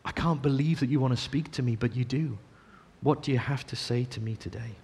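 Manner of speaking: soft reverent prayer voice; humble prayer. Acted. Preacher modelling a humble prayer to God, soft and reverent. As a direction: pray it softly and humbly. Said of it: "to just come and say".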